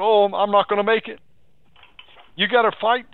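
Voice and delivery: normal talking voice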